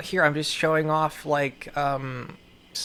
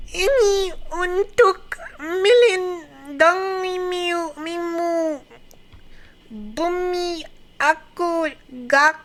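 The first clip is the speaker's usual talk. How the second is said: very high, slow, strained, tearful character voice; dramatic anime reading; 13 semitones higher, slower than usual. Acted. Reading Indonesian comic lines aloud, high sobbing overacted voice. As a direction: wail the lines like a crying anime heroine. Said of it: "I'm gonna try to read this".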